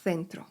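"Centro" starting with a lisped "th"; tongue between teeth. Shown as a demonstration. Teacher showing Spanish CE, "Centro" with a "th" sound. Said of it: "z językiem między zębami".

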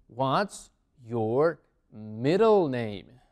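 very slow, word by word; spaced-out, deliberate language-teacher delivery; slow teaching model. Shown as a demonstration. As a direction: stretch out each word for learners. Teacher models new question slowly.

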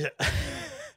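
short, high nervous laugh; rire jaune. Real, not acted. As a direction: laugh awkwardly, without joy. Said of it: "un rire jaune que je fais là". (rire jaune gêné et bref)

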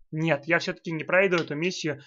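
ordinary delivery

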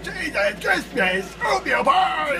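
high, loud, stretched-out yelling; strained high voice. Real, not acted. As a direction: keep the voice pushed up high and loud. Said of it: "why am I still talking like this?".

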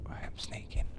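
whispering. Real, not acted. Sneaking past zombie in game.

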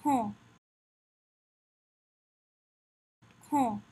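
French "Quand" with a nasal vowel and silent d; "Quand" modelled in French for learners; French pronunciation drill. Shown as a demonstration. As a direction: say "Quand" as "kon", without sounding the n or d. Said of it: "Practice the pronunciation with me".

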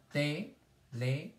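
"Televisor" slowly split into four separate syllables. Shown as a demonstration. Teacher counting syllables for the class, "Televisor" syllable by syllable. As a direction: break "Televisor" into te, le, vi, sor with pauses. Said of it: "dos sílabas en el medio".

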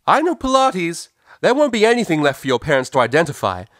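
high, full-force threat; loud, forceful shout. A narrator's reading. Mare bluffing colts into running away, forceful shout. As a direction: bellow the bluff at a high pitch. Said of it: "never shouted so loudly and forcefully".

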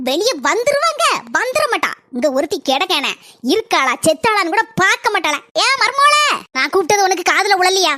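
loud cursing call; yelling out. Acted. Mother-in-law yelling for her daughter-in-law, loud. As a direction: shout it across the house.

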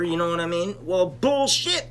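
usual speaking voice

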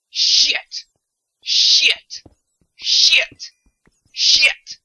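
repeated explosive cartoon curses, very loud; cartoonish yelp. Player replaying their own curse, cartoonish yelp. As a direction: burst out each one like a cartoon. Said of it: "Sounded like such a fucking cartoon".

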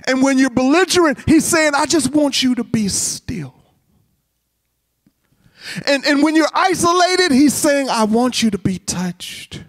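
high, forceful calls easing at each close; impassioned preaching. Real, not acted. Preacher telling the congregation what Jesus wants, impassioned. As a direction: cry out each case, then ease into the answer.

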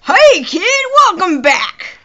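high, loud country greeting; country accent. Acted. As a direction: belt it out in a hammed-up country twang. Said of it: "That's country".

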